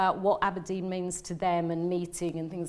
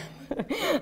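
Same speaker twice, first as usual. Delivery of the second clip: a quick rising laugh at herself; short laugh; 9 semitones higher, 3 dB quieter than usual. Real, not acted. Realising she is more Scottish, a short laugh. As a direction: laugh it off, a little embarrassed.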